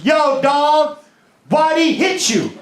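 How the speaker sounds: lightly voiced hyped bystander. Comic voicing the bystander filming on his phone. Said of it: "he goes".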